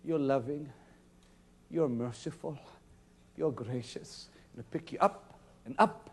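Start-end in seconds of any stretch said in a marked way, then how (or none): 0.0-4.3 softer, worshipful prayer. Shown as a demonstration. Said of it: "you should say".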